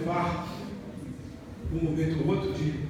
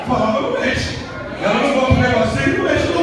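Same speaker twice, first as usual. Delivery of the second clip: loud preaching; 4 semitones higher, 7 dB louder, faster than usual. Acted. Preacher rebuking, raised voice.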